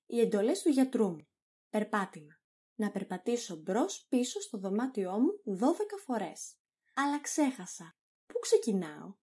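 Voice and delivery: ordinary talk, nothing marked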